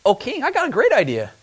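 sly helpful suggestion to the king; eager eunuch. Retold or imitated. Eunuch proposing a plan to the king, eager. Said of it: "a eunuch that stands up and says".

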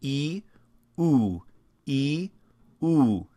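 E then oo, tongue lifting and lowering in turn; alternating E and oo. Shown as a demonstration. Teacher drilling front and back vowels, switching E and oo. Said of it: "go back and forth between those".

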